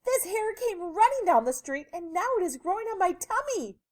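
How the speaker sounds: high-pitched frantic complaint; high, upset lady voice; distressed lady. A narrator's reading. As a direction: voice a storybook lady in a tizzy.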